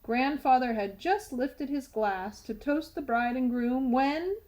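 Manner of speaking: ordinary delivery, nothing marked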